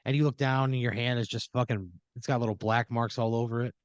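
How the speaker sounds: ordinary delivery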